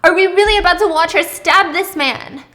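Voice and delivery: loud, high panicked yell; panicked screaming. Acted. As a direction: scream in horror at the scene. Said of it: "[name] is screaming".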